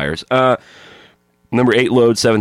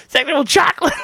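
extremely high falsetto; Randy Watson impression. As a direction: shriek it like a showman. Quoting Randy Watson scene.

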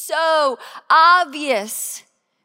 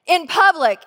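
raised, smug quote; louder mock know-it-all; mock self-righteous; 4 semitones higher, 6 dB louder than usual. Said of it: "people go".

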